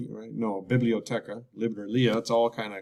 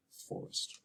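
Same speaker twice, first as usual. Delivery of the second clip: whispered; 3 semitones lower, 17 dB quieter than usual. Shown as a demonstration. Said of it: "whispered repeat".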